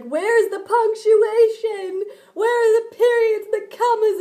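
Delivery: high, loud mock wail; mock complaining. Student imitates classmates criticising her poems, high mock wail.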